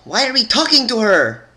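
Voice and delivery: high, loud agitated pegasus voice; agitated shout. Acted. Pegasus demanding why they talk to Twilight, agitated shout. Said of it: "flapped her wings in agitation".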